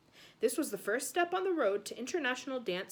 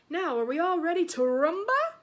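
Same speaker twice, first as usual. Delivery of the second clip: put-on higher, louder showman with an Italian accent; Italian host accent; 7 semitones higher, 6 dB louder than usual. A narrator's reading. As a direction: rev up the crowd in a hammy Italian accent. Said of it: "his Italian accent".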